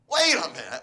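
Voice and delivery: loud acted jolt of realization; indignant outburst. Spouse realizing the big mistake, loudly indignant. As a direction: burst out loud, stopping yourself short.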